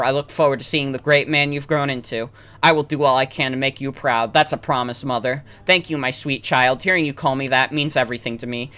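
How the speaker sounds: soft, low loving mother's farewell; tender mother. Acted. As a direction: speak to him like a son in dreams. Voices the mother in game.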